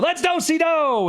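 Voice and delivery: high, rousing call; eager exclamation. A narrator's reading. Teacher agreeing to try the plan, high eager cry. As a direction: sing out high, ready to go.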